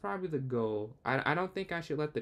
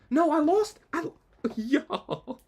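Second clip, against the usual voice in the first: high, loud disbelieving yell at losing; shocked outburst; 10 dB louder, slower than usual. Real, not acted. Streamer yelling in shock at losing a won game on time. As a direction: blurt it out as the win slips away, half laughing.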